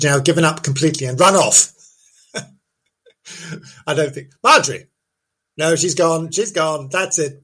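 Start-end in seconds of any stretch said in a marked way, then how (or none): none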